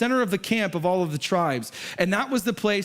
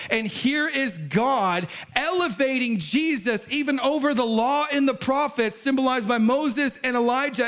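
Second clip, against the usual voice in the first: preaching at full force; 6 semitones higher than usual. Acted. Preacher to congregation, impassioned preaching. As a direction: lift the room as the preacher.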